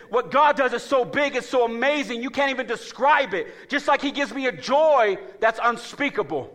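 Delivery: high, loud, slowed preaching cadence; full-force preaching. Acted. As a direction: preach it out to the church.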